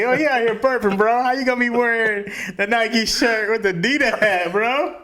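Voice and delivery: loud, high-pitched incredulous heckle; mock call-out. Kid teasing a friend over mismatched brands, loud mock outrage. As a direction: imitate a kid loudly calling out a fashion crime. Acts out mocking kids' call-out.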